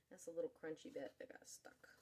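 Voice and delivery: soft whispered aside; whispering. Real, not acted. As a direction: whisper it to yourself. Aside while plating.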